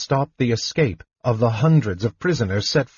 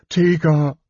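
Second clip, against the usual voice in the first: higher, louder anguished cry; pained call to the fallen woman; moaned; 6 semitones higher, 4 dB louder than usual. A narrator's reading. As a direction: cry out her name in grief. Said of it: "Caramon moaned".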